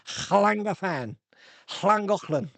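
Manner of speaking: "Llangofan" and "Llangollen" with exaggerated throaty Welsh LL; drawn-out, phlegm-filled Welsh place names; phlegmy Welsh. Shown as a demonstration. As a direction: hack out the LL of "Llangollen" like clearing phlegm. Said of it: "you need a mouth and a phlegm for, for, for Welsh".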